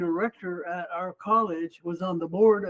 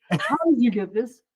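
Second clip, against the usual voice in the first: higher, louder incredulous question; imitated astonishment; 4 semitones higher, 5 dB louder than usual. Clerk sees the citizenship certificate, retold astonished demand. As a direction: act out a clerk's baffled outburst.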